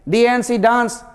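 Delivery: ordinary talk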